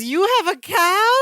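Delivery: high-pitched amazed question; very excited. Retold or imitated. Balki mistakes a jersey for cattle, very excited. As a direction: imitate thrilled surprise, rising at the end. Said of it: "Very excited".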